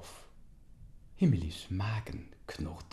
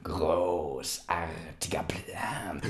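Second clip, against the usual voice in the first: slow whisper; 2 semitones lower, much slower than usual. Acted. Uncle whispers agreement.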